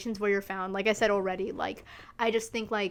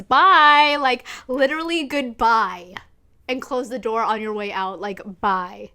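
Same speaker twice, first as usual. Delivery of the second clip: emphatic goodbye; 8 dB louder than usual. Acted. Dismissing men from her life.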